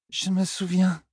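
put-on high, slurred young woman's voice; a drunk girl remembering her childhood; tipsy young Elaine. Elaine drunk, recalling Tom's moustache, high and slurred.

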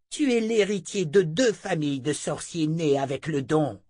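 high, heated tirade; angry seer in a high voice; surge of anger. A narrator's reading. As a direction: spit out the insults, dragging key words. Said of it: "De nouveau la colère la submergea".

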